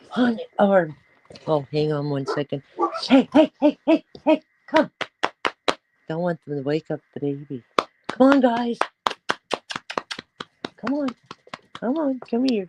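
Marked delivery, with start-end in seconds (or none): 3.0-4.9 high, loud calls to get the dogs; hey hey called high and loud across the room; calling the dogs. Real, not acted. 8.1-12.7 coaxing the dogs. Real, not acted.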